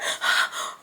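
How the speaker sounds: quick acted gasp of panic; imitated gasp. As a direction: gasp like a frightened little sister. Voices sister left waiting.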